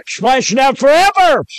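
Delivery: high hellfire preacher's cry. Acted. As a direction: build to a high, ringing shout. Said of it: "crying out".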